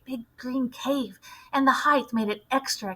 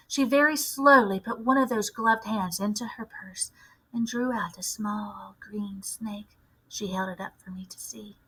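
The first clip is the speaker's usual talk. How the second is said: whispered narration; 3 dB quieter than usual. A narrator's reading. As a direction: breathe it to build dread.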